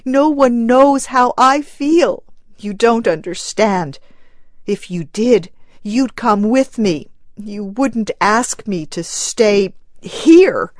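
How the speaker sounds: grieving mother pleading with her husband; choked sobbing plea. Acted. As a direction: force the words out through grief. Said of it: "choked the woman".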